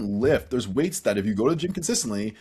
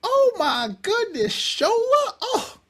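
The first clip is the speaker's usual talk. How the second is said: high, slow, gushing Southern belle voice; imitating a gushing Southern accent, much higher and slow; 4 dB louder, much slower than usual. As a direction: gush it out like an amazed Southern lady. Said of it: "she had a Southern accent".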